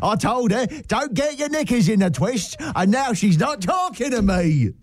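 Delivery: hammed-up Cockney lad; Cockney accent. Cockney lad complaining about his girlfriend's nagging.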